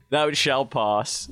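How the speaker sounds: loud, high, drawn-out decree; mock-grand proclamation. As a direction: proclaim it like a pompous command. Repeats forged motto grandly as joke.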